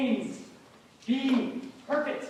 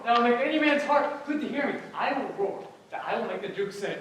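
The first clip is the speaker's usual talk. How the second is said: ordinary talk, nothing marked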